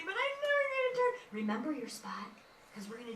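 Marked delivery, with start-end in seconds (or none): 0.1-1.1 mock whiny kid, higher and fast; a light mock pout; pouting child. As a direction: whine it like a sulky child. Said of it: "don't pout and say".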